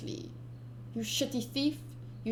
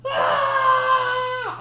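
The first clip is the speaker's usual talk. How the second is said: high, loud mock scream of pain; pained yell; 10 dB louder than usual. Ace Ventura shot in the leg, hammed-up scream. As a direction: yelp like you've just been shot. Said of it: "and then he's like".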